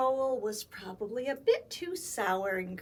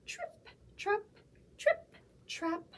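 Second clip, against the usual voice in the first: trip trap chant; 9 semitones higher than usual. Acted. Goat crossing bridge sound.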